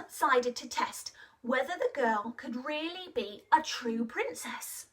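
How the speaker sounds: usual speaking voice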